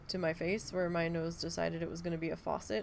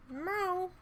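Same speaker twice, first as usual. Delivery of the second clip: meow; 11 semitones higher than usual. Real, not acted. Meowing back at her cat.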